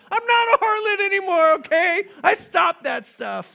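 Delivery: very high, loud comic protest; exasperated mock shout. Rahab in heaven objecting to her nickname, exasperated mock shout. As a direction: shriek it in hammed-up indignation.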